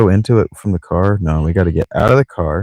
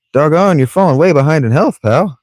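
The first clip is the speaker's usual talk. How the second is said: mock scolding; 9 semitones higher, 4 dB louder than usual. Teasing the game character aloud.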